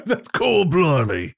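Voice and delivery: high cockney voice with a laugh; lightly put-on south London accent. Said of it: "my London accent".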